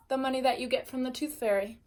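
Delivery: ordinary delivery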